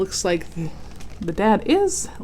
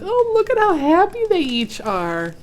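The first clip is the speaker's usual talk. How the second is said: high loud delight; overjoyed; 11 semitones higher, 6 dB louder than usual. Acted. Reader to listeners at happy ending, gushing. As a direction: gush with happiness.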